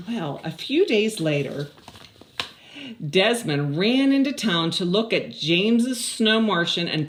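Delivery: lively, even storybook read; reading to children. Acted. Teacher reads the story aloud to her class, lively storybook voice. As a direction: read it out like a story to little kids.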